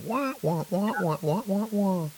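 rising wah wah trombone voice; muffled wah wah talk. Retold or imitated. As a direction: do Charlie Brown's teacher wah wah. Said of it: "it starts sounding like Charlie Brown's parents".

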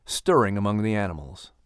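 low, even storyteller voice; story narration. A narrator's reading. Narrator setting the scene for listeners, calm storytelling. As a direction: read it like a bedtime story.

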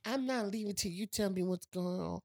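put-on high, whiny stubborn teenager voice; mock her as a bratty, stubborn girl; mock stubborn wife. Mocking the hard-headed wife from the movie in a whiny voice. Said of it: "mimicking the stubborn wife".

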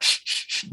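repeated voiceless shushes; urgent shushing. Retold or imitated. Dad hushing his son in the theater, urgently. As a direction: hush a kid in short bursts.

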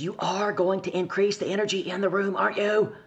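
loud, high, insistent imitation; urgent demand. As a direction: press it right into the listener's face. Grabbed by shoulders, urgent demand retold.

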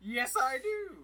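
loud high eager yell; mock enthusiastic cry; excited shout. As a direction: echo it with glee. Listener echoes quote.

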